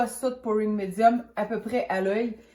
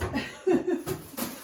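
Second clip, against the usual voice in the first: breathy high giggle; quick airy laugh at herself; 9 semitones higher than usual. Real, not acted. Laughs at her own mistake.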